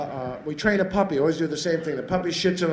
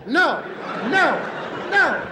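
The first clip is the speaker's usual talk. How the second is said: strenuous no; 8 semitones higher, slower than usual. Retold or imitated. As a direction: scold the puppy hard. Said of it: "utter disapproval".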